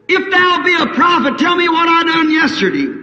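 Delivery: high, loud taunting dare; scoffing challenge. Retold or imitated. Scoffers in the crowd dare the prophet, loud and high. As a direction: toss the challenge at him like a jeer.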